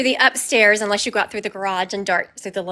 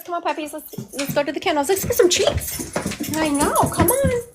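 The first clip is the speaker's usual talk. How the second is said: coaxing the dogs; 7 semitones higher than usual. Real, not acted. Calling dogs upstairs.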